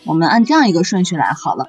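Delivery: normal talking voice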